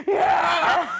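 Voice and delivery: loud laughing New York cheer; cheering shout. Retold or imitated. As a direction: yell it with a laugh. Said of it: "I'm from New York, so it's like".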